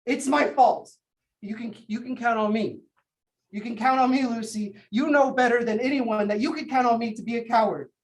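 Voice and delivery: loud, bitter self-accusation; self-blaming outburst. Acted. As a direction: hurl the blame at yourself. Guilty outburst at sister.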